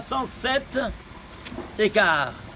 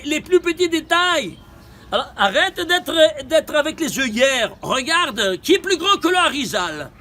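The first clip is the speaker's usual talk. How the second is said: raised, higher-pitched rebuke; indignant exhortation; 5 semitones higher than usual. Real, not acted. Teacher scolds students wearing blinkers about the Arizal, indignant. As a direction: challenge them with rising fervour. Scolds those who ignore the details.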